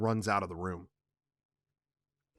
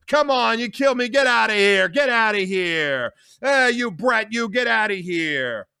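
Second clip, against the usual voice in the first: higher, loud, dismissive; contemptuous shouting; 10 semitones higher, 9 dB louder than usual. Acted. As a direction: wave her off with scorn. Carlo dares and dismisses.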